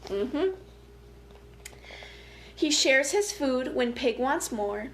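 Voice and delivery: ordinary delivery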